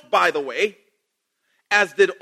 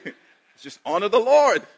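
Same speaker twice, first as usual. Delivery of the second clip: usual speaking voice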